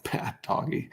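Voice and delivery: soft whispered "bad doggy"; mock dog scolding, whispered.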